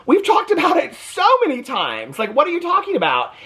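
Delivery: a hint of Janelle's disbelief; exasperated Janelle. Recapper quoting Janelle's disbelief at Cody. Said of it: "And she goes".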